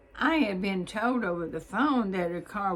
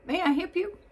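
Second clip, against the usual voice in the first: quick, much higher northern brogue, a bit louder; higher northern clerk asking to help; 10 semitones higher, 4 dB louder, faster than usual. Retold or imitated. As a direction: mimic a brisk Minnesota clerk, pitched up. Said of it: "in her fast northern brogue".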